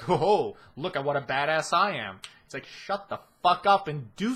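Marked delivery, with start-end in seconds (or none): 0.0-2.2 louder, gloating mock boast; sneering parody of an anime boast; mock smug bragging. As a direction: ham up the show-off.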